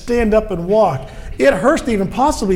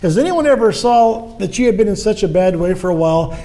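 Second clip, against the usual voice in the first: normal talking voice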